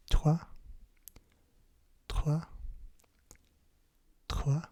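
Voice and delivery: loud "trois" with the R in the back of the throat; don't roll the R in "trois", rasp it in the throat; guttural French R. Shown as a demonstration. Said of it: "saying his R's like that".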